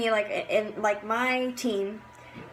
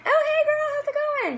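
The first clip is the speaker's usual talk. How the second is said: very high, bright, bubbly hello; bubbly excited greeting; 3 dB louder than usual. Shown as a demonstration. Greeting a teammate in a voice message, very high and excited. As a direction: sound thrilled to hear from her. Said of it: "I want you to hear my tone of voice".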